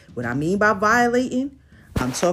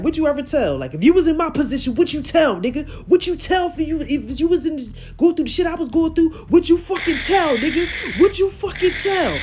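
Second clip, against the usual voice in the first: high, loud frantic yelling; impression of 6ix9ine; 9 semitones higher, 4 dB louder than usual. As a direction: snap and shout the question over and over.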